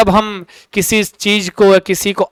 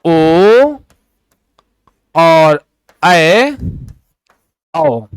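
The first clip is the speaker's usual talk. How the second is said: each vowel stretched long and low; drawn-out vowels; 4 semitones lower, much slower than usual. Shown as a demonstration. Teacher to pupils, dictating vowels long and slow. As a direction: sound out each vowel slowly for the class. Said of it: "क्या लिखोगे?".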